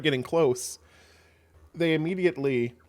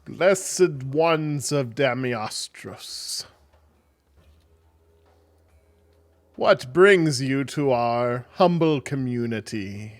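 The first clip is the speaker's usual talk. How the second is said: slow, loud, grand welcome; stretched-out, resonating deity's address; booming voice; 8 dB louder, much slower than usual. Acted. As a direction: proclaim it like a hidden god. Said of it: "you hear a booming voice".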